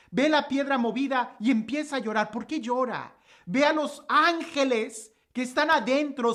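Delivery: loud, rising sermon voice; fervent preaching. Acted. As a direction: preach it out with full force to the congregation. Preaching at full force. (predicando con fuerza y voz alta)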